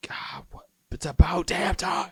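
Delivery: retold whisper, slow. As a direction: breathe it out like a secret aside. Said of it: "And I'm like".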